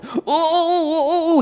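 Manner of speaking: imitating a man in shock; shaking panic. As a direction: golfer realizing he smoked pot with a minister, high panicked moans. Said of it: "Greg starts violently shaking".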